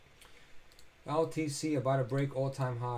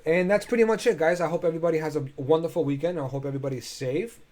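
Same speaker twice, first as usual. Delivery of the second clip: cheerful sign-off; 6 semitones higher, 11 dB louder than usual. Real, not acted. Upbeat sign-off to viewers.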